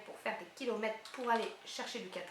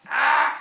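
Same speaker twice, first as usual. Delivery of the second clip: startled cry; 14 dB louder than usual. Real, not acted. Struggling to open package.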